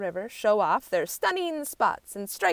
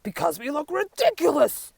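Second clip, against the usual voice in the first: chorus of animals; 8 semitones higher, 6 dB louder than usual. A narrator's reading. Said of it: "everyone says together".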